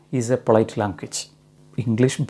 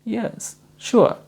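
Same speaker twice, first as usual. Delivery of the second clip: high gentle yes, sure; high eager reply; 8 semitones higher than usual. Shown as a demonstration. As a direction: answer high and keen, glad to help. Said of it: "എന്തായിരിക്കും സ്വാഭാവികമായും അതിൻ്റെ answer?".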